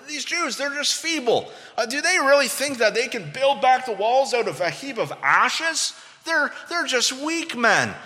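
high-pitched, scornful imitation; mocking. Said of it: "they're mocking the people of God".

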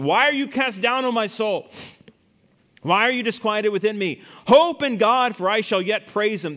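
high, projected scripture cry; psalmist challenging himself. Acted. Psalmist urges his soul to hope, loud challenge. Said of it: "He talks to himself".

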